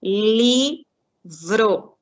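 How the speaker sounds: LIVRO split into LI... VRO, each drawn out; "livro" in two separate, stretched beats; slow syllable-by-syllable. Shown as a demonstration. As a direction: count out the syllables of "livro" slowly and loudly. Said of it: "Se eu falar devagar, eu já separo".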